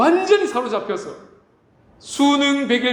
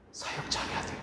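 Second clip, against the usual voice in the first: whisper; 16 dB quieter than usual. Retold or imitated. Inner voice of ambition.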